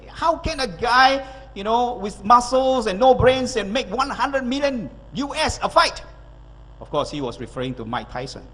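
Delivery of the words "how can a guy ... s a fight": high, slow mock indignation; upset businessman. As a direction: imitate an outraged complaint, drawn out and raised. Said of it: "a businessman was very upset about this boxing".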